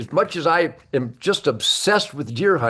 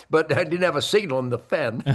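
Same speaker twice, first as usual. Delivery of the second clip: normal talking voice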